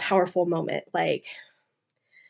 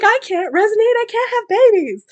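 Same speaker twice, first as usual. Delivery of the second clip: high, loud, anguished; despairing protest; 13 semitones higher, 7 dB louder, faster than usual. Retold or imitated. Kira insisting she cannot have babies, high anguished cry. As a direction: cry out that it's impossible. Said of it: "She's like".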